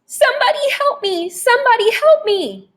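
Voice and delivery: high, panicked scream for help; terrified cry for help. A narrator's reading. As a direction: Wendell flees the vampire, screaming high for help. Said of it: "Wendell was sobbing as he ran".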